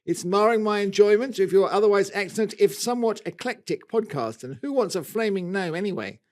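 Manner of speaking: lively mock-Australian letter reading; Australian accent. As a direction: read the complaint in an amateur Aussie accent. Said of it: "that's a really bad Australian accent".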